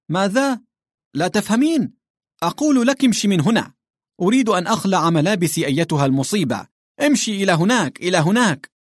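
high, loud exasperated yelling; furious shout at the governess; angry shouting. A narrator's reading. Yelling at the uncomprehending Englishwoman.

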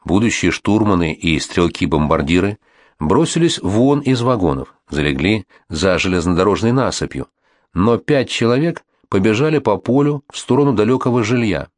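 plain reading voice

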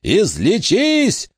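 very high, long-drawn cry; agonised high-pitched healing command; desperate scream. A narrator's reading. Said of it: "закричал Уэйн".

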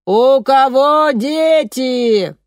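high, slow megaphone call; megaphone shout. A narrator's reading. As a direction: call out to the crowd through a megaphone. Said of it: "закричала в мегафон".

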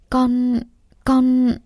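halting, broken-off faltering start; stammering. A narrator's reading. Daughter cornered by her family's questions, stammering. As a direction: stall on the word, unable to go on. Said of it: "cô ấp úng trả lời".

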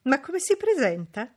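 plain reading voice

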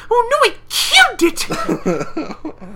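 loud, slow falsetto cry; Fluttershy horrified wail. Retold or imitated. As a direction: wail in mock horror, drawn out. Voicing horrified Fluttershy breaking toy.

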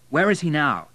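pressing for an answer; impatient. A narrator's reading. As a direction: demand it impatiently. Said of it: "asked William impatiently".

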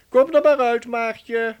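loud triumphant call. Real, not acted. Professor loudly calls girl out of clock.

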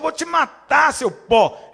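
loud, booming threat of death; God condemns stubborn man, booming; God's threat. Pretend. God threatening the proud man, loud.

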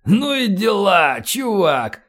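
slow, high, drawn-out amazement; astonished, drawling rapper; amazed exclamation. A narrator's reading. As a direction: exclaim in wonder, stretching the words. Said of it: "удивлённо воскликнул Джей Ти".

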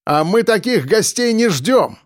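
high, defiant reply; calling back. A narrator's reading. Defender answering the attacker outside, calling back. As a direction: call back a challenge. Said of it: "отозвался я".

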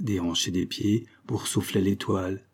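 plain reading voice

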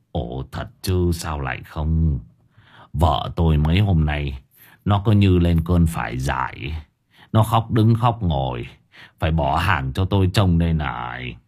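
an anxious father breaking bad news, low; old vendor voice. A narrator's reading.

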